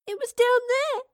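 put-on high, loud tearful child; sobbing child. A narrator's reading. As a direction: sob it out like a scared girl. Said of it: "sobbed the girl".

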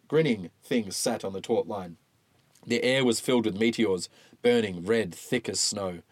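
usual speaking voice

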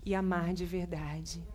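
slow, soft closing prayer. Real, not acted. Pastor closing a prayer for the church, slow and soft. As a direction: pray it out gently and slowly.

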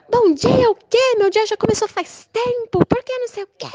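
mock her greeting in a shrill voice; caricatured angry neighbor.

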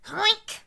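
monkey squeal. Acted. Monkey noise in story.

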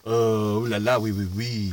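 low, soft mock French. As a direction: purr it like a lazy Frenchman.

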